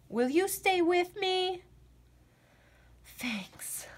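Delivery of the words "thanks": "Thanks." whispered very softly, falling; scared whisper. Acted. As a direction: breathe a nervous thanks. Scared frog thanks friend.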